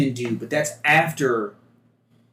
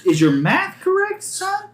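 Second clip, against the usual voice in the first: higher Southern gunner, teasing; gunner role voice; 9 semitones higher, 5 dB louder than usual. Acted. Gunner teasing the newcomer about his math, higher teasing voice. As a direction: raise the pitch and rib the new guy.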